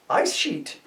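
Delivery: Ice sheet said high as a Scot; Scottish Jock. Acted.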